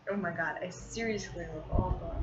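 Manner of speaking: normal talking voice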